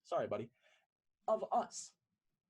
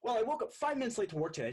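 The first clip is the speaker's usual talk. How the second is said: rushed rant; 6 semitones higher, 7 dB louder, much faster than usual. Acted. Person B launches into venting.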